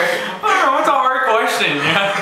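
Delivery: high, laughing embarrassed excuse. Real, not acted. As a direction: laugh it off, squeaking. Embarrassed after a silly answer.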